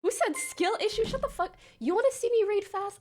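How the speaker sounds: high-pitched, affronted retort; indignant comeback. Real, not acted. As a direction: fire back at a taunt. Indignant comeback to chat taunt.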